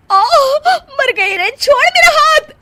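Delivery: high, loud pained yelp; pained cry. Acted. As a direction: cry out as the arm twists. Arm being twisted.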